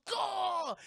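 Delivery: mock pained yell. Baldy getting shot by Barbossa, a high hammed-up yell. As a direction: yelp like a pirate taking a bullet.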